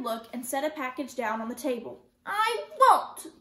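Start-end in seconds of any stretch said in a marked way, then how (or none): 2.3-3.4 put-on high, loud child's protest; sputtering refusal. A narrator's reading. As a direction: burst out in stubborn protest. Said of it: "Kirsty sputtered".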